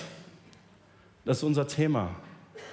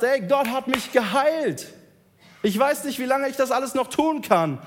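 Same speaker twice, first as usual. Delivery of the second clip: reckless excitement; 7 semitones higher, 4 dB louder than usual. Acted. Healed man justifies his spending spree, giddy. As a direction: savour every word like a man gone wild.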